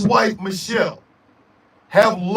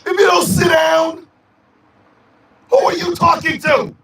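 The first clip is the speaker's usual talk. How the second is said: sassy shout; 6 semitones higher, 3 dB louder than usual. Pretend. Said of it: "tell 'em".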